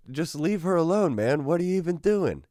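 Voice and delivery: high-pitched retold protest; exasperated confrontation. Narrator tells the gym guy to back off, exasperated. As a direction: plead indignantly, voice jumping up high.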